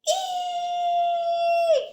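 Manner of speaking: shrill scared shriek; high yelp. Acted. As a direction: shriek in fright. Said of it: "yelled Sam".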